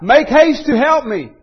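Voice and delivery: loud, high, pleading cry of "haste". Retold or imitated. Preacher reliving his own desperate prayer, crying out loud. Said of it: "I was crying out".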